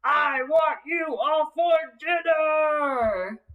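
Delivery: slow, booming troll roar; troll yell. Acted. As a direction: bellow it like a hungry troll. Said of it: "Here's what he yelled".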